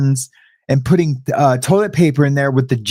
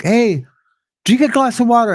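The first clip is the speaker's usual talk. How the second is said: higher, matter-of-fact cellmate voice; lightly voiced cellmate telling him what to do; imitating the older cellmate's calm command; cellmate's advice; 8 semitones higher than usual. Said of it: "he's like".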